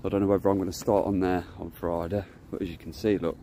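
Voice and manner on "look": usual speaking voice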